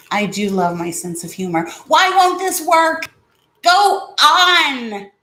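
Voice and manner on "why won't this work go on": high, slow, frustrated pleading at the remote; exasperated pleading. Real, not acted. Vlogger begging the fan remote to work, exasperated and high. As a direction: beg the broken remote, voice jumping high.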